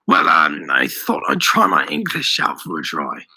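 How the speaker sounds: low, faltering excuse; hesitant bluff. A narrator's reading. Daniel excuses his English to the shopkeeper, hesitant.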